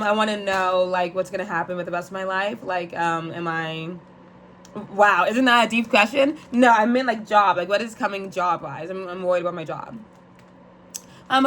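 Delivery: ordinary talk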